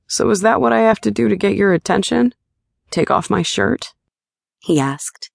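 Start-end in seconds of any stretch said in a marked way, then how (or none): 0.1-3.9 light playful male voice; teasing her with a grin; lightly voiced flirty partner. A narrator's reading. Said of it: "heated and flirty expression".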